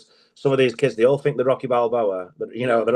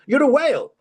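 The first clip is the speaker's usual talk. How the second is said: much higher, chirpy trainer voice; posh trainer's verdict; 4 dB louder than usual. Retold or imitated. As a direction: deliver the insult brightly like it's praise. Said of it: "he goes".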